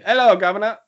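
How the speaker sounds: high mock-English greeting; lightly hammed English accent. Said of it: "can I do it in an English accent".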